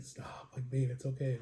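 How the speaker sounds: very soft, low, nearly whispered; barely voiced, placating murmur; hushed calming. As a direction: imitate soothing an angry partner under your breath. Calming partner who is going off.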